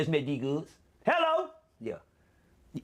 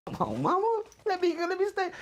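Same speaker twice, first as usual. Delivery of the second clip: much higher, pleading whine; 13 semitones higher, slower than usual. Retold or imitated. Son begging mama from the police car, high pleading. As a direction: beg your mama in a high voice.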